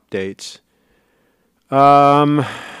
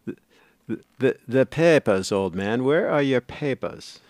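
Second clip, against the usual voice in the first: lightly put-on German border guard demanding papers; a hint of a stern German official; repeating the German-accented bit the chat asked for; mock German accent; slower than usual. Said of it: "with that accent".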